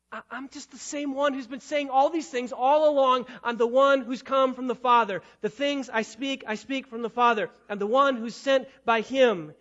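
loud, insistent restating; exasperated. Acted. Jesus repeating himself to the Pharisees, loud insistence. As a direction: drive it home, fed up.

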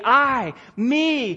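"I" and "me" each punched high and set apart; emphatic I. Shown as a demonstration. Preacher showing the congregation the emphatic Greek pronoun, emphatic. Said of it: "it is always emphatic".

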